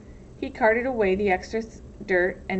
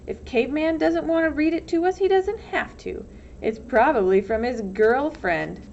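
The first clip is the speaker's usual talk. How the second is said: put-on teasing boy; 6 semitones higher, 3 dB louder than usual. A narrator's reading. X-ray teasing Stanley.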